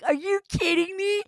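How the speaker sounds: high squealed are you kidding me; loud high mock shock; mock disbelief. Retelling Peter's reaction.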